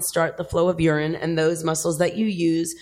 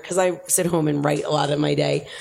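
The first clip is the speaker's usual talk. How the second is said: usual speaking voice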